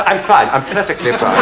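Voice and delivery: ordinary talk, nothing marked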